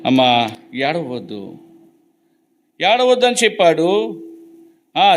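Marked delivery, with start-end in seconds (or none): 0.0-1.6 hushed, slow consolation on అమ్మా; tender comfort. Acted. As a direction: soothe a grieving mother gently. Same words repeated to the widow.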